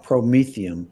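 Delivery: "Promethium" with a long ee in the middle, loud; corrected pronunciation. Shown as a demonstration. Doctor correcting the host's reading of the drug name, corrected pronunciation. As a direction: say "Promethium" as pro-MEE-thee-um. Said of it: "is how you pronounce it".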